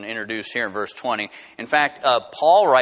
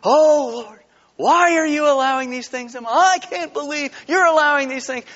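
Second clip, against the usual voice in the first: high, loud, incredulous wail to God; mock complaining believer; 10 semitones higher, 4 dB louder than usual. Believer complaining to the Lord about trials, mock dismay. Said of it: "this is how we talk".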